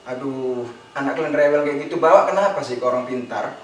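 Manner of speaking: neighbour's low-pitched grumble; retold nagging. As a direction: complain wearily in a low voice. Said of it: "tetangga ngomel". (omelan tetangga bernada rendah)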